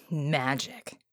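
low, soft, disgusted mutter; one bitter word, low and quiet. Acted. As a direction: spit out the word in distaste. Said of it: "he muttered in disgust".

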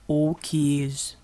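rocky rumble. A narrator's reading. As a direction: rumble it like a collapsing cliff. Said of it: "Chug rumbled".

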